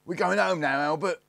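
high, loud call across a distance. A narrator's reading. Eldest calls to hidden Albert, loud high call. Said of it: "called the eldest".

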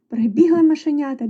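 plain reading voice

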